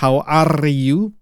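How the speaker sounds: "are" with a trilled R; rolled R. Shown as a demonstration. Teacher showing learners the Indonesian habit, rolled R on "are". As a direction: roll the R in "are". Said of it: "kalau bahasa Inggrisnya rolling R".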